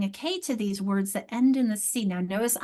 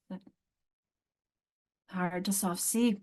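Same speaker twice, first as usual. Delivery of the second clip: ordinary talk, nothing marked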